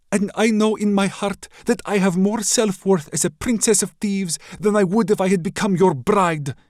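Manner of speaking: put-on high, loud, defiant young princess. Princess defies the raider prince, loud and high. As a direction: stand tall and refuse him proudly.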